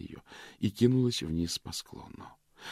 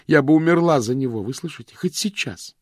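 passionate vow; 8 semitones higher, 9 dB louder than usual. Acted. Vivien swears passionately.

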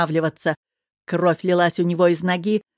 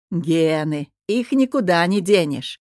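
usual speaking voice

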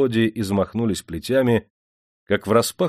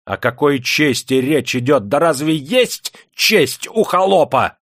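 high, loud incredulous scorn; raised, indignant mockery; scornful outrage; 5 semitones higher, 5 dB louder, slower than usual. A narrator's reading. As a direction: rise into a slow, contemptuous challenge. Enraged master scorns serf's honour.

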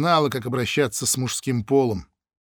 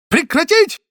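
very high, loud screamed order; furious yell; 8 dB louder than usual. Acted. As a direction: scream them out of the room. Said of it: "напрасно орал Г П Ушник". (яростный ор)

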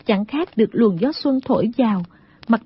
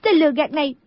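higher-pitched female rebuke; mildly angry scolding; 4 semitones higher, faster than usual. Acted. Girl cursing the man who fooled her, angry. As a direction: scold him in a raised pitch. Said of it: "tức giận mắng".

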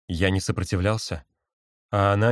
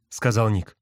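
usual speaking voice

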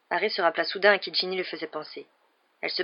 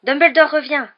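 much higher, louder happy shout; joyful cry; 9 semitones higher, 11 dB louder than usual. A narrator's reading. Students guessing the news at breakfast, joyful. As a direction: cheer the news. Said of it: "d'un ton joyeux".

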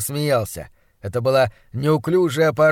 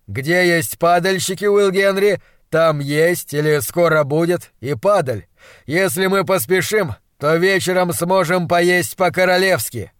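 loud, higher, energetic doctor voice; eager monstrologist; 4 semitones higher, 5 dB louder than usual. Acted. Doctor promising a royal supper if they hurry, eager. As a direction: spur the boy on with a promise.

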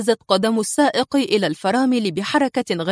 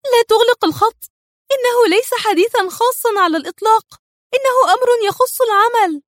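much higher, louder woman's voice; urgent, begging him to stay on; wife insists to her husband on the line; pleading wife; 13 semitones higher, 4 dB louder than usual. A narrator's reading. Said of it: "هتفت".

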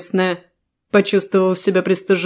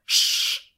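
hushing shh sound; 8 dB quieter than usual. A narrator's reading. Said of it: "издала звук".

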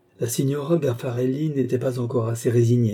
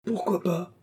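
a high, softer Pourquoi pas?; exclaiming; 8 semitones higher, 4 dB quieter than usual. A narrator's reading. Wife answering her jealous husband, a high airy exclaim. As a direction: toss the question back lightly, up high. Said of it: "s'écria sa femme".

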